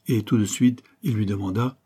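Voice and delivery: plain reading voice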